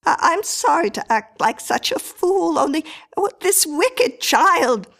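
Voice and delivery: high, faltering, distressed voice; upset apology. A narrator's reading. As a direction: apologise haltingly, still shaken by the child. Mrs Miller apologises, still upset.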